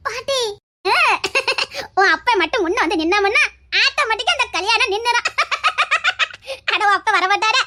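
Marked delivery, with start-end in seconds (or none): 1.0-1.9 high mischievous cackle around the plan; gleeful scheming cackle; cackling laugh. Acted. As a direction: cackle like a sly grandmother. Grandmother cackles over her idea.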